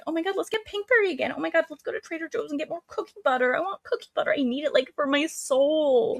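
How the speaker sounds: put-on very high, fast girly gushing; mock bunny chatter. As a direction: parody excited clique girls in falsetto. Mimicking bunnies chattering as they walk.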